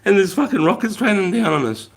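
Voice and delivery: high-pitched, laughing mock complaint; re-enacted giddy incredulity; giggling disbelief. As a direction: squeal it through giggles. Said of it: "So we started giggling".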